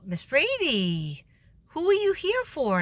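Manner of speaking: slow, then a high sing-song question; imitating office staff. Office staff questioning the late substitute, slow and high. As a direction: drag out the name, then ask up high.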